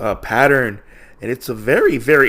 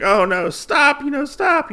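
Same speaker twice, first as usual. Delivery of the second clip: high-pitched pleading, acted out; mock panicked plea; 14 semitones higher than usual. Usagi held up by the ears by the wolf, mock panicked plea. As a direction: imitate someone dangled and begging.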